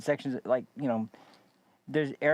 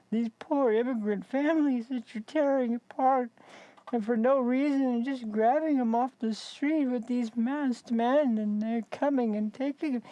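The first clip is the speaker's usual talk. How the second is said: high, put-on bleeding-heart whine; mock pleading protester; 11 semitones higher than usual. Mimics protesters' sob story.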